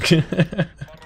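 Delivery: high, breathy nervous giggle; short anxious laugh. Real, not acted. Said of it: "You can tell by my nervous laugh".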